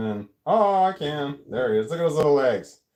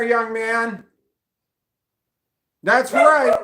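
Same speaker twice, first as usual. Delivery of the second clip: high, loud hammed-up stern parent; mock scolding yell; 10 semitones higher, 5 dB louder, much slower than usual. Owner scolding the dog like a child, mock-angry yell. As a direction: bark it like an exasperated mum.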